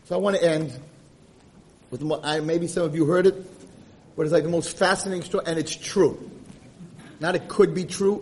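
ordinary talk, nothing marked

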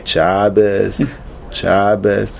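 "Shabbos, Shabbos" mumbled soft without "Good"; just a soft "Shabbos, Shabbos", no "Good"; dropping the G. Shown as a demonstration. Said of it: "you can drop the G completely".

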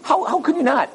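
mock outrage, pitch climbing; angry husband. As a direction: act out a worked-up husband's rising outrage. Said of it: "He gets so angry".

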